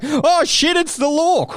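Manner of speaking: high-pitched comic alarm; hammed-up panicked yelp; mock panic. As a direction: cry out as if caught by police. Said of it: "It's like".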